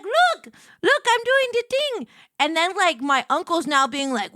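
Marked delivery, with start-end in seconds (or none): 0.0-2.1 much higher and louder squeal; childlike show-off; giddy look-at-me. Retold or imitated. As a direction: bounce with excitement. Showing family her setup.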